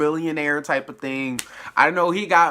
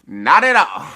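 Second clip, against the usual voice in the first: emphatic denial; 3 semitones higher, 11 dB louder than usual. Real, not acted. Joking denial about rainy night out.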